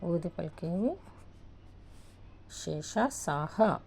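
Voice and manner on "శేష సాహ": slow dictation. Shown as a demonstration. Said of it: "teacher dictating letters slowly".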